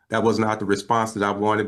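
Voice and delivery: usual speaking voice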